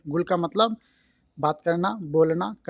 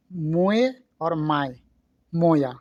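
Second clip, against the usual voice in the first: slow teaching demo; much slower than usual. Shown as a demonstration. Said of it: "तीनों word आप बोल सकते हैं।".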